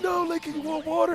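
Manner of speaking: high, laughing fake-caring voice; mock concern. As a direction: pretend to care while cracking up. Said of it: "I'm like".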